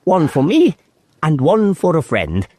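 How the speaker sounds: a kindly faun offering tea; a hint of a friendly faun; Mr Tumnus. A narrator's reading. Tumnus voiced in story.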